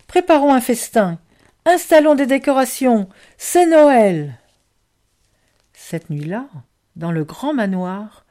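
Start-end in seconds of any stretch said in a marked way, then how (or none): 0.1-4.4 loud, high, rousing orders; rally everyone with an excited shout; crying out. A narrator's reading. Boustru rallies the children for Christmas, crying out. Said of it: "cria-t-il".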